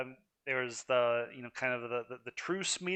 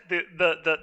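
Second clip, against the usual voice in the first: tertawa keras sambil tergagap di kata the; laughing; 5 semitones higher, 8 dB louder, faster than usual. Real, not acted. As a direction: laugh through the stammered words. Laughs mid-rant about the story.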